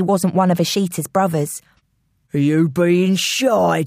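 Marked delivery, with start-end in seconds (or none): 2.3-3.8 slow, taunting London man; intruder's voice. Acted. Intruder taunting a hiding man, London villain voice. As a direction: tease slowly as a London villain. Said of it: "said the first voice".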